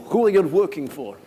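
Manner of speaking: mock menacing boss voice. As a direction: interrogate like a hammed-up eighties movie boss. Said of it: "So the boss walks in".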